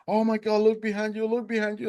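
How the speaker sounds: very high, urgent exclamation; retold amazement. Boatman spots something behind swimmer, excited high alert.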